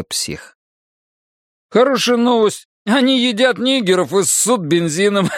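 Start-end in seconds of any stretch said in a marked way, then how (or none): 1.7-5.3 high-pitched joker cracking himself up; bursting into laughter. A narrator's reading. Bobby tells his own joke, laughing. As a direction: blurt the punchline high, breaking into laughter. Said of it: "выдал Бобби и расхохотался".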